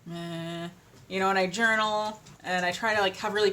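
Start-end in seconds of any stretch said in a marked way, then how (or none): 0.1-0.7 bored meh. Retold or imitated. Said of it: "I'm like".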